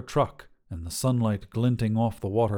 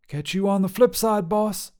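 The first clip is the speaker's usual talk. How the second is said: higher, bold trucker woman voice; tough lady trucker; 9 semitones higher, 3 dB louder than usual. Acted. Trucker Sharon sizing up a hitchhiker, brash and higher. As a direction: call out like a brash long-haul trucker.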